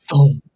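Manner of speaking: a loud, punchy thump. Retold or imitated. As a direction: slam the word like an impact. Acting out the hard landing.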